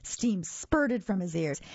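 ordinary delivery

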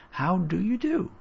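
put-on high-pitched, polite little boy's greeting. A narrator's reading. Boy greets the uncle he never knew, high and polite. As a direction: lift the pitch, politely formal. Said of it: "Tommy said politely".

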